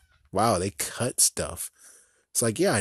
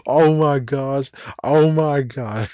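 usual speaking voice

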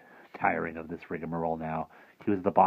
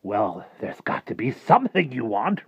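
lightly voiced coaxing huckster, higher and louder; Rictus coaxing; 5 semitones higher, 6 dB louder than usual. Rictus trying to tempt Harvey on the stairs, coaxing. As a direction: coax the boy with a lifted, louder voice.

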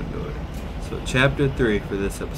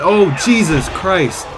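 loud, higher, still rattled; shaken exclaiming; 7 semitones higher, 9 dB louder than usual. Real, not acted. Gamer still reeling from the scare, shouting. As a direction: blurt it out in shock. Reacting after jump scare.

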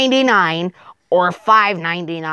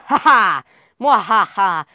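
hammy villain cackle; evil laugh; 2 semitones higher than usual. Acted. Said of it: "Evil laughter sound".

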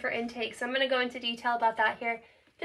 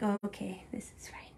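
whispered pretend acceptance, very soft; mock whisper; 3 semitones lower, 10 dB quieter than usual. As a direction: voice the cat's reaction in a whisper. Voicing the cat's grudging acceptance.

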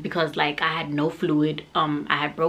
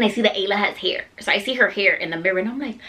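normal talking voice

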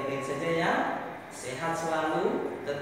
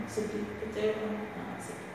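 softer, sad demo fading to a whisper; showing sadness; 5 semitones higher, 6 dB quieter than usual. Shown as a demonstration. Teacher demonstrates a sad expression for pupils, fading to a whisper. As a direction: let the sadness sink into a whisper. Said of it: "Tapi kalau sedih, ya ditunjukkan".

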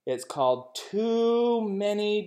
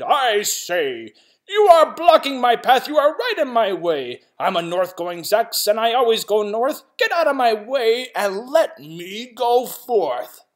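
loud, higher, indignant protest; indignant shout; 6 semitones higher, 7 dB louder than usual. Acted. North-going Zax demands the path, loud and indignant. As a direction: demand he get out of your way. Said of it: "the north-going Zack said".

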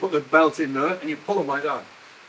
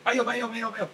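imitated warning call; 8 semitones higher, 4 dB quieter than usual. Miner mimicking the shout of a runaway haulage, imitated warning call. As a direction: sing it out high like a distant warning. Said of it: "You might hear it".

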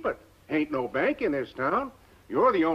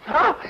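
startled yelp; 12 semitones higher, 6 dB louder than usual. Acted. Barber yanks him in chair.

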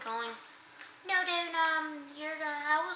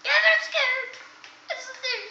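much higher squeaky fear; scared candlestick; 9 semitones higher, 5 dB louder, slower than usual. Acted. Candlestick tells David it is scared, high and squeaky. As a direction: squeak it like a frightened little sidekick.

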